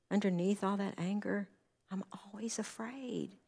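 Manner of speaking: quoted in a soft whisper; hushed confession. Retold or imitated. Counselor quoting a client's fearful confession in a whisper. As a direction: let the admission drop to a whisper.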